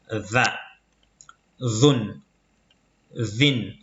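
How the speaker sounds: "Va, Zun, Vin" each begun with voiced th, as in this; tongue tip between the teeth for "Va, Zun, Vin"; interdental consonant. Shown as a demonstration. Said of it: "le bout de la langue entre les incisives".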